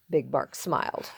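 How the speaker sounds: ordinary delivery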